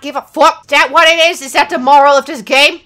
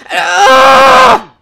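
loud high scream; long, piercing yell; 13 semitones higher, 10 dB louder than usual. Real, not acted. Gamer falls back down, loud scream.

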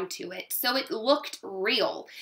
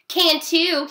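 louder, higher sibling comeback; countering; 6 semitones higher, 5 dB louder than usual. A narrator's reading. Brother argues with sister over ballet, countering. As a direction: shoot back a childish retort. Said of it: "he countered".

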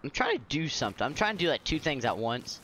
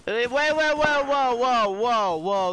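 high, loud startled whoa; 7 semitones higher, 5 dB louder, slower than usual. Real, not acted. Gamer jolts at an ambush, high startled cries. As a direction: jump at the sudden threat.

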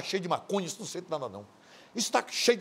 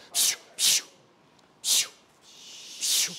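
mock hushing; slower than usual. Hushing the girl.

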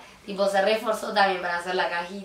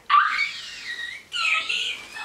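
excited squeal. Real, not acted. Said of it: "Me voy a quedar sin voz".

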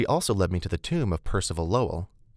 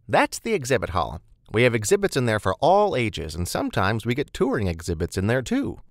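higher, lively guide voice; chatty tour-giving Beth; 4 semitones higher, 4 dB louder than usual. Acted. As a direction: show the newcomer round with bubbly energy.

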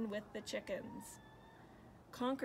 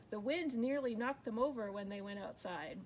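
ordinary delivery, nothing marked

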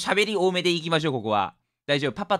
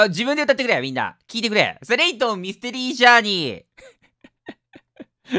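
ordinary delivery

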